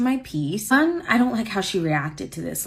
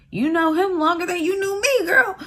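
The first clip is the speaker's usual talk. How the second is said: exasperated; 9 semitones higher than usual. Retold or imitated. Exasperated reply to absent friend.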